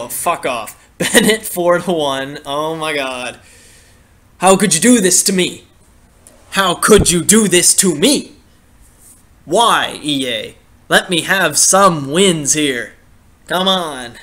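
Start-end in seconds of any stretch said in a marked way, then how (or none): 4.4-5.6 crying with rage. Real, not acted. Losing game, complaining to game. 6.5-10.6 crying with rage. Real, not acted. Repeated louder anguished plea.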